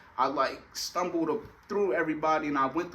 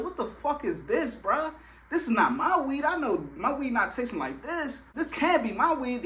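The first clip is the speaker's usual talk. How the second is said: higher, indignant complaint; disgusted outrage at the bad weed; outraged; 7 semitones higher than usual. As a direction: demand to know what this is. Tasting strange weed, outraged.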